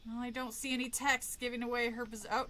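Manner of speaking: normal talking voice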